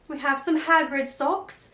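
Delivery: ordinary talk, nothing marked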